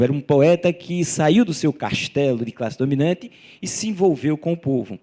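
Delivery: normal talking voice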